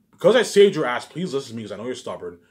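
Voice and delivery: ordinary delivery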